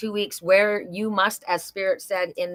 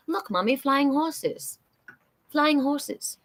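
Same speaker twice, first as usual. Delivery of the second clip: put-on high, drawn-out English-accented child voice; English accent, pitched up in childlike wonder; little girl's English accent; 7 semitones higher, much slower than usual. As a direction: imitate a small girl with an English accent. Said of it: "with my English accent".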